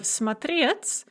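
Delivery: смотреть with the stress on -реть; stress on second syllable. Shown as a demonstration. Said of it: "stress on the second syllable".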